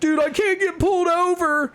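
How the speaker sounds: very high-pitched imitation of a frantic driver; mock panicked whine. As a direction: plead in exaggerated high panic. Retold panicked driver fleeing police.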